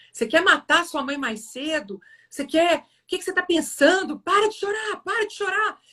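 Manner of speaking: retold telling-off, climbing in pitch; harsh scolding. Father ordering his crying son to stop, scolding. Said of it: "muito fortes".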